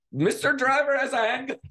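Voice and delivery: high-pitched, hammed-up bystander cry; horrible impersonation. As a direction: do a bad mock voice of someone in the crowd. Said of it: "doing a horrible impersonation of them".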